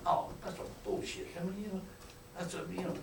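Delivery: hushed, almost whispered grumbling; bench grumble. Retold or imitated. Players grumbling on the bench, hushed near-whisper. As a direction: mutter it like a gripe on the bench. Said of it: "here's what we say".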